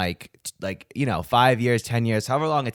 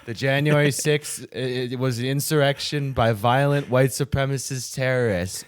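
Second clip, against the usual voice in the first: brainwashed recital; 5 semitones higher than usual. Pretend.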